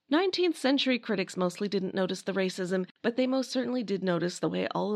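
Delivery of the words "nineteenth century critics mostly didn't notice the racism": normal talking voice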